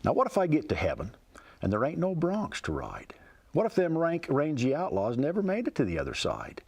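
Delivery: poem recited with a folksy lilt; cowboy poetry. Acted. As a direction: tell it like a rhyming cowboy yarn. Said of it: "It goes like this".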